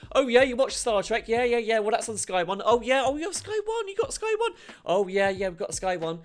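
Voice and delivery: high, chummy sales patter; pally sales agent. Retold or imitated. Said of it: "They'll be a bit pally".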